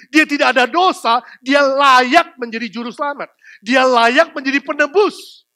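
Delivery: loud, high, driving sermon climax; preaching at full force. Acted. Preacher to congregation, loud driving sermon. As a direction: hammer the gospel home from the pulpit.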